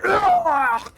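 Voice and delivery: sudden high yelp of pain; high pained yelp from the struck guy; pained cry. Acted. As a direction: cry out as the blade hits. DM voices struck guy's cry.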